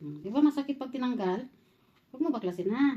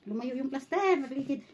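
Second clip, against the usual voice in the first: normal talking voice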